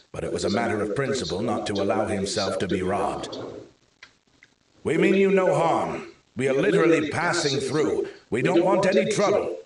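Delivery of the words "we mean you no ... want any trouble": higher, louder call from cover; shouting back. A narrator's reading. Man hiding behind a car shouts to the guards, loud and urgent. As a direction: shout reassurance across the road. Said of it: "he shouted back from his hiding place".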